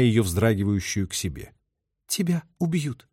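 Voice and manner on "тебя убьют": high, strained woman's voice; choked-out distress; forced out. A narrator's reading. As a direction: squeeze the words out, pitched high. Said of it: "выдавила она".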